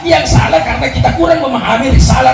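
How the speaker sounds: fiery mosque sermon build; rising preaching fervour. Acted. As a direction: preach it harder line by line, peaking on tidak salah. Preacher building to full force.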